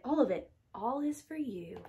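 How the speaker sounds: plain reading voice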